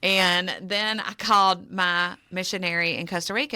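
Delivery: ordinary talk, nothing marked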